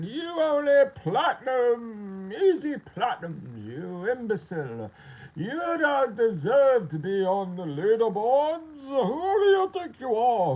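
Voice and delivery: high, slow, drawn-out indignant rant; hammed-up loud wail of indignation; mock outrage. Critics berating him over the leaderboards, mock outrage.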